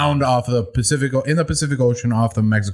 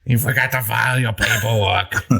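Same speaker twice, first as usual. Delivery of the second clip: Mike Wazowski voice. Retold or imitated. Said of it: "Mike Wazowski".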